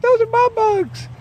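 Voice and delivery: high, slow, possessive little hen voice; voicing the hen. Owner voicing her hen Half Pint over bugs, high slow squeak. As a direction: squeak it out slowly like a greedy hen. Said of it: "Half pint's like".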